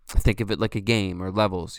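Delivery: ordinary talk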